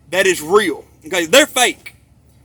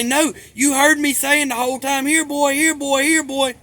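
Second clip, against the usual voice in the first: higher-pitched mock cop excuse; imitating a cop; 7 semitones higher than usual. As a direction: put on an innocent cop, pitched up. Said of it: "the cop's gonna say".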